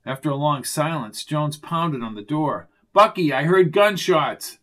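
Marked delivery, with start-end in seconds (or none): 2.9-4.6 loud urgent call; urgent shout. A narrator's reading. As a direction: shout the alarm. Shouting through door.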